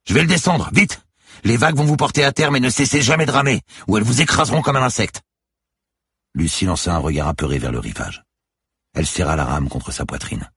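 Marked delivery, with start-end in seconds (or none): 0.1-5.2 high, loud warning; forceful skipper's shout; urgent shouted orders. A narrator's reading. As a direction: yell instructions over breaking waves. Skipper shouting orders during launch.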